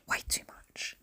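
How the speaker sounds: whispered under her breath; a muttered whispered afterthought; whispered aside. Real, not acted. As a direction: drop to a whisper for the repeat. Repeating herself under her breath.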